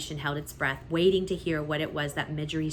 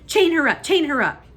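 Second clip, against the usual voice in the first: high, loud repeated command; angry order; 10 semitones higher, 10 dB louder than usual. A narrator's reading. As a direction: snap it twice. Rat orders chaining the girl.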